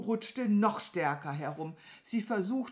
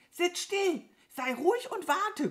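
high, sharp crow order; exasperated command; 13 semitones higher, 4 dB louder than usual. Acted. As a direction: snap at the restless cow. Crow orders restless cow to wait. (genervt und hoch befehlen)

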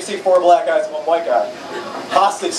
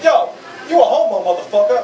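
higher, hammed-up street tough; a light jab at the tough guy; mock thug; 6 semitones higher than usual. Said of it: "this guy's like".

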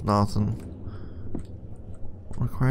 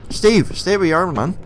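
rattled, pitched-up shout; alarmed; 9 semitones higher than usual. Real, not acted. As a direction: raise your voice to ward off the pursuer. Warding off pursuing Steve.

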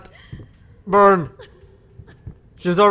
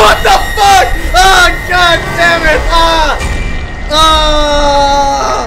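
high, loud shocked yells; frightened yelling; 9 semitones higher, 6 dB louder, much slower than usual. Real, not acted. Gamer screaming at a ghost lady, loud frightened yells. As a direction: yell in real fright. Jump scare reaction.

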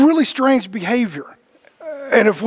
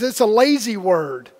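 normal talking voice

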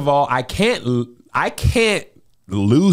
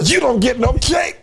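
mock sobbing wail; 9 semitones higher than usual. Mother retells son's kindergarten goodbye.

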